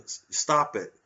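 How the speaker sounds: usual speaking voice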